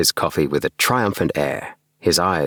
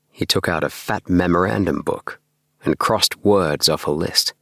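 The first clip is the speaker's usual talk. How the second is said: plain reading voice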